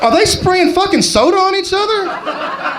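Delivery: high-pitched, incredulous outburst; mock shocked disbelief. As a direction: act appalled, voice shooting up high. Acting shocked alien tour guide.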